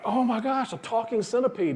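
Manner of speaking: amazed at his new find; excited gasp. Retold or imitated. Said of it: "He's so excited".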